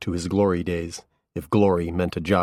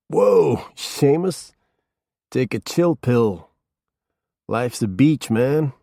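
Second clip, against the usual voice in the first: higher, slow stoner drawl; laid-back surfer stoner voice, sliding about; stoner accent; 6 semitones higher, 4 dB louder, slower than usual. A narrator's reading. Stoned Norman laughing off the detective, slow stoner accent. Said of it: "stoner accent that slipped among maybe six different tones".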